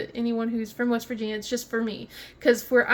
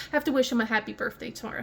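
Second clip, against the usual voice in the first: ordinary delivery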